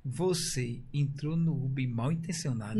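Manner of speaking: slow, low, hushed interrogator voice; mock expert analyst. Host poses as a facial expressions analyst to a guest, slow and low. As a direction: play a grave analyst, very slow.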